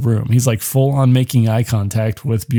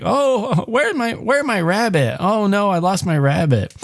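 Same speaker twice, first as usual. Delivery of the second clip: put-on little girl voice; 7 semitones higher than usual. Daughter Agnes asking her parents for her lost rabbit, high little girl voice. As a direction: play a small girl upset over her toy. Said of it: "Agnes starts asking, like".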